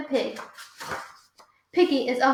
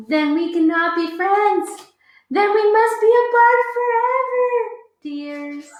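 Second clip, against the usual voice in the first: higher, louder melodramatic goodbye; dramatic farewell; 8 semitones higher, 7 dB louder than usual. Acted. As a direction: ham up the tragic parting.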